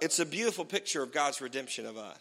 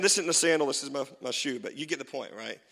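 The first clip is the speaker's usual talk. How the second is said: ordinary delivery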